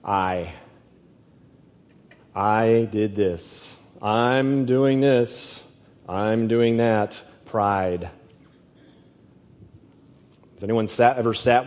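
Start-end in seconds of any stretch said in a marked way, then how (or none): 2.4-7.2 slow, proud I did this; stress on I. Shown as a demonstration. Preacher mocking pride, slow and self-important. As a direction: drag each I out smugly. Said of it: "middle letter in the word pride".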